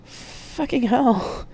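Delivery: slow shocked gasp. A narrator's reading. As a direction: breathe it out in shock. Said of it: "Jack gasped".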